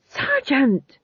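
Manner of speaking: high exclaimed rebuke; sharp reprimand. A narrator's reading. King stopping his shouting sergeant, sharp reprimand.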